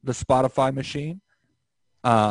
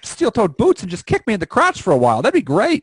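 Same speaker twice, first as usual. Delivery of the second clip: higher, loud sarcastic comic rant; mock Bill Burr; 6 semitones higher, 4 dB louder than usual. Joining the bit, a comic's sarcastic rant, pitched higher. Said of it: "I don't know why we sound so".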